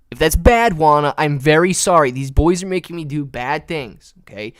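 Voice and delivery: ordinary talk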